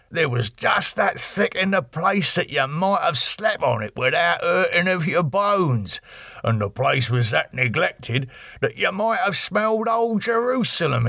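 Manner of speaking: high, rough cockney labourer's voice, chatty and awed; cockney workman. Acted. Workman telling Harker about Carfax, high cockney voice. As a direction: play the old workman spinning his yarn, pitched well up. Workman recounts the haunted house.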